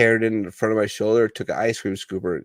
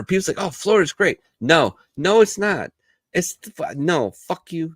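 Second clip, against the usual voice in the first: high, loud ranting; worked-up rejection of Florida; heated rant; 7 semitones higher, 4 dB louder than usual. Real, not acted. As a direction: shoot down the idea hard.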